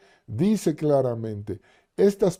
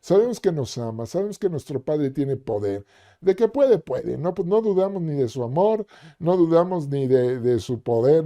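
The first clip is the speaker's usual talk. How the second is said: ordinary talk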